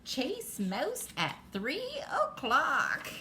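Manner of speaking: playful cat reading its note slowly; storybook cat. Acted. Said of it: "And the cat says".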